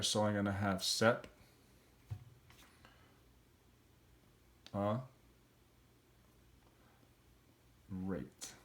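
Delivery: sep, a, rate with long pauses between; syllables split apart. Shown as a demonstration. Teacher splitting separate into syllables for the class. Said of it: "let's see the split".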